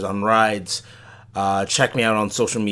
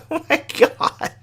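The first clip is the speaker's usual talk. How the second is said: surprised. Retold or imitated. Retold shock at price.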